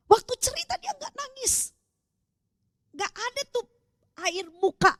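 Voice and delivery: high, hushed aside; hushed disbelief. Real, not acted. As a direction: drop to a soft, high near-whisper. Hushed emphasis on shocking detail. (suara lirih bernada tinggi)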